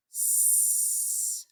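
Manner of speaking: "S" as a bare unvoiced hiss; just hiss the "S" with no voice; whispered S. Shown as a demonstration. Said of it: "without the".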